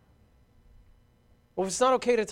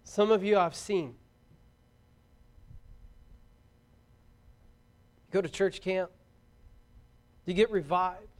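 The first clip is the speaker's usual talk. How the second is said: earnest preaching appeal; 4 dB quieter than usual. Real, not acted. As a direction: plead quietly, leaving long pauses.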